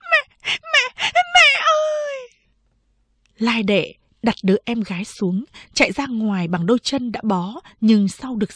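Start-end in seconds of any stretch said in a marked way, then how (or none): 0.1-2.3 very high-pitched wail, last word drawn out; crying scream. A narrator's reading. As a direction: shriek for mother in tears. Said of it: "khóc thét lên". (khóc thét gọi mẹ, giọng cao vút, kéo dài)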